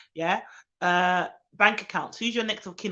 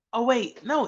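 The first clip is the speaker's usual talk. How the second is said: usual speaking voice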